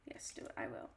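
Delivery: usual speaking voice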